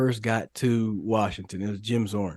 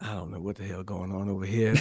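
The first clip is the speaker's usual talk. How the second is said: low bewildered mutter; 5 semitones lower, 7 dB quieter than usual. Retold or imitated. Rookie confused by the coach's speech, quietly baffled. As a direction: lower and softer, puzzled aside. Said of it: "I was just like".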